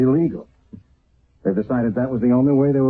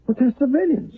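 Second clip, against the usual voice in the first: shocked protest. Acted. Sergeant protests the airstrike.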